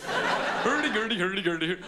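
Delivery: high sing-song mock Swedish; slow, bouncy cartoon-Scandinavian gibberish; Swedish chef. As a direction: impersonate a puppet chef's nonsense babble. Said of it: "do their own Swedish chef impersonation".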